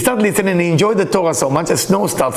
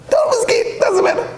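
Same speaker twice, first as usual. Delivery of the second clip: excited high cry. Real, not acted. Preacher to students, thrilled and much higher. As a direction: voice shoots way up in praise. Preacher praising forefathers' generosity.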